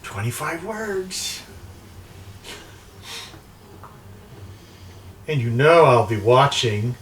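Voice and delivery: lightly voiced sorceress with swooping high pitch; imperious crystal-ball mistress. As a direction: play a scheming enchantress setting her terms. Game master plays crystal-ball woman.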